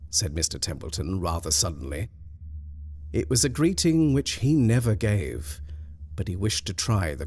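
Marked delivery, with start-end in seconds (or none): none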